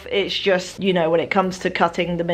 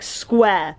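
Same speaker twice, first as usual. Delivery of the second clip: usual speaking voice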